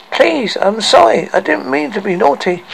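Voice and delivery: high, begging voice; acted childlike pleading; pleading apology. A narrator's reading. Captive boy begging his captors.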